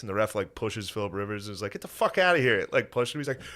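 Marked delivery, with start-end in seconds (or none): 1.7-2.6 higher-pitched exasperated quote; mock fed-up referee; retold dismissal. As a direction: shoo him off, voice jumping up. Retold ref shoving player away.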